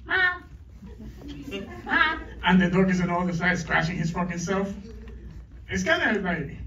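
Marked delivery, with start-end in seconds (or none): 0.0-2.2 high mewing cat imitation; high-pitched imitated cat meows. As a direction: meow like the cat in her face. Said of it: "and the cat was, like".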